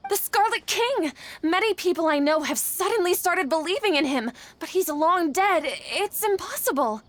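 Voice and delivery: urgent alarm, insisting it can't be; agitated disbelief. Acted. Alarmed report of heretics.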